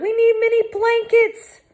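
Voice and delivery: high loud urgent call; shouted. A narrator's reading. Hector shouting for blankets for the girls. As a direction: order it urgently.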